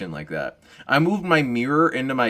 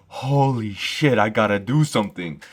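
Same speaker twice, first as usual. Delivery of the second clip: fired-up; 4 dB louder than usual. Retold or imitated. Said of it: "And they're like".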